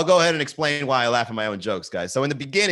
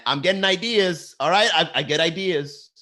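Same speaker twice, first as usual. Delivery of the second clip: higher-pitched tough-guy impression; Robert De Niro; 5 semitones higher than usual. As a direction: riff it as a worked-up movie star. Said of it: "I'm like Robert De Niro".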